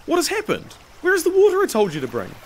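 high, rapid-fire questioning; urgent exclamation. A narrator's reading. Master questions slave returning without water, urgent. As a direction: demand answers in alarm.

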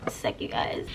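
unvoiced quick whisper aside. Real, not acted. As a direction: whisper it off to the side. Aside while fixing the setup.

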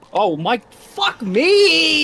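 high screaming, drawn-out curse; panicking gamer. Real, not acted. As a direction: scream in shock and despair. Caught by Jeff, dies.

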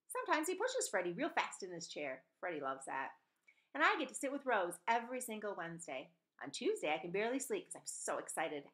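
ordinary delivery

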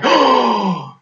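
high gasped oh of shock; retold shock. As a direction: gasp it out, high and aghast. Retold shock at near miss.

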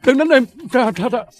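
high, loud, frantic pleading; terrified high yelps of please don't; begging for mercy. Acted. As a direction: cry out to the thug not to hit you. Thug beating him, begging mercy.

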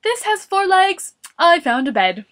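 excited yell, loud and high at first; Morris. A narrator's reading. Morris showing Boris his find, yelling. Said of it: "Morris yelled".

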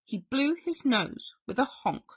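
plain reading voice

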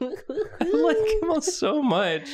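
high, slow laughing gush; high delighted laugh. Real, not acted. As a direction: squeal it, slow and giggly. Delighted by drawing.

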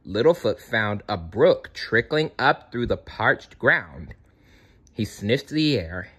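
ordinary delivery

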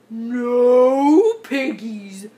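very slow, loud cow drawl; big bovine voice, vowels stretched; mooing cow. A narrator's reading. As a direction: moo the words out long. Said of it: "mooed the cow".

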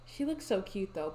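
ordinary talk

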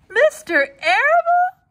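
high slow sobbing question. Acted. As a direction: sob it out in disbelief. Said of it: "sobbed Wilbur".

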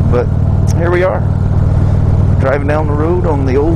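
talking loud over road and wind noise on a moving motorcycle. Real, not acted. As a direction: project the voice over the engine and wind. Talking while riding motorcycle in wind.

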